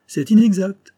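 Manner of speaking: high, loud protest; stung, forceful denial; indignant rebuttal. Acted. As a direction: burst in to contradict him. Doctor rebuts the sneer.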